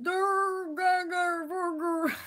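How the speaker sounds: high, loud mocking caveman growls; dumb caveman grunts. Mocking critics as grunting cavemen, loud and dumb. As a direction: grunt like a brainless brute to mock them. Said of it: "the people who were like".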